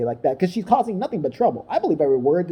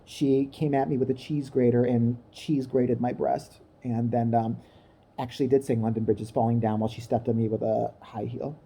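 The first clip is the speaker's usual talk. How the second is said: mock composed Meghan; 6 semitones lower, 5 dB quieter than usual. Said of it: "her next remark would have been".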